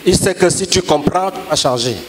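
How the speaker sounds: ordinary talk, nothing marked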